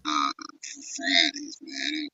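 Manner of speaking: muffled phone line, voice dropping out. Real, not acted. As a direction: talk through a bad muffled phone. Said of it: "you sound muffled".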